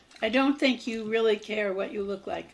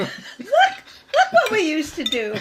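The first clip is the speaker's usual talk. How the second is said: playful mock voice; 8 semitones higher, 6 dB louder than usual. Mock future selves watching.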